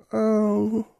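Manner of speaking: put-on a wistful little girl's oh; Ketcha's sigh.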